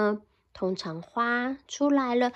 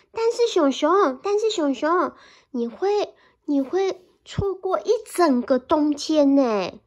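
high, louder little mouse voice; pleading mouse; 4 semitones higher, 5 dB louder than usual. Acted. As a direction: beg the bear as the small mouse.

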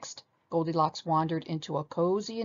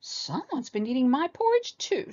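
high, sulky little bear voice; high childish pout; sulking baby bear; 8 semitones higher than usual. A narrator's reading. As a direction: sulk like a small cub. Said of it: "sulked Baby Bear".

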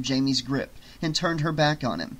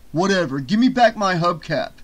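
loud, raised-pitch demand; exasperated raised voice; angry demand; 4 semitones higher, 6 dB louder than usual. Acted. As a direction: demand your property back. Eric demands his hubcap.

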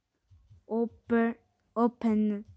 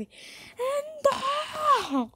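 demo scream; 13 semitones higher than usual. Shown as a demonstration. Showing viewers how she'll scream at the jumpscare, high mock shriek. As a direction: shriek like a crazy woman, but playful. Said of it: "vedrete me urlare come una pazza così".

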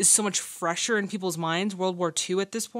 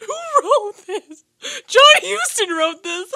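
high, loud, laughing and near tears; half laughing, half crying; emotional laughing; 13 semitones higher, 11 dB louder than usual. Real, not acted. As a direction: gush at the writer's genius. Overwhelmed by emotional scene.

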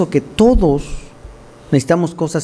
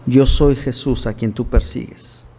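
voice of Jesus; 5 semitones lower, slower than usual. Acted. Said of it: "Y dijo".

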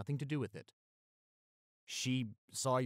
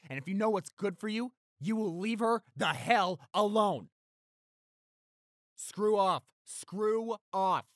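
furious shout; 10 semitones higher, 9 dB louder than usual. Acted. Shouting attacker away.

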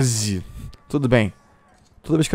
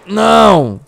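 high, loud no; anguished shout; 7 semitones higher, 8 dB louder than usual. Real, not acted. Gamer losing a fight, loud anguished no. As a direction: cry out as you lose. Killed in game.

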